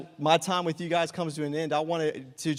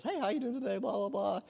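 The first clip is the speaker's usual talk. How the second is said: customer-service patter; 6 semitones higher than usual. Acted. Restaurant worker to a customer, rote customer-service patter. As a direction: put on a high customer greeting voice. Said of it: "it's customer service".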